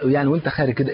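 ordinary delivery

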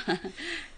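soft high chuckle; a quick coaxing little laugh; gentle giggle trailing up; light laugh. Acted. Matchmaker laughs after suggestion.

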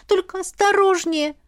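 high, anxious plea; worried girl imploring; pleading. A narrator's reading. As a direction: beg her to take care, pitched high. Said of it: "взмолилась Ила".

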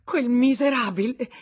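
loud, high-pitched furious cry; explosive outburst. A narrator's reading. Woman exploding about the man who betrayed her, furiously. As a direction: burst out in outrage. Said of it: "Tutto a un tratto esplose".

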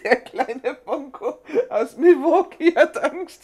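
high, slow, laughing taunt; mocking laugh. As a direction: laugh at the coward. Boss mocks scared sidekick laughing.

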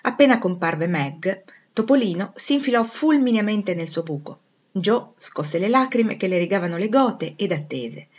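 plain reading voice

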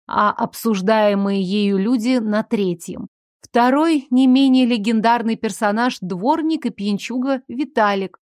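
ordinary delivery

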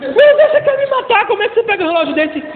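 husband shrieking at the price, then rushing; shocked husband. Retold or imitated. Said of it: "aí ele diz".

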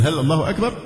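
quick question, أكبر rising at the end; asking tune. Shown as a demonstration. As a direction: say هل الله أكبر as if really asking. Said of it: "كأنه بيسأل". (نبرة سؤال على «أكبر»)

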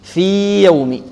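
في drawn out long, then يوم begun cleanly; very slowly hold the i of في; clear separation. Shown as a demonstration. Teacher repeating the example, long separated vowel. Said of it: "نظهرها".

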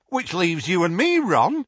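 perky and eager; bright. A narrator's reading. Tonks cheerfully pairing up with Ron. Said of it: "said Tonks brightly".

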